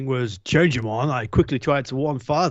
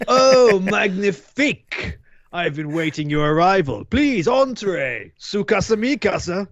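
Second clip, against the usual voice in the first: hammed French accent; 4 semitones higher than usual. [name] greets the new chefs.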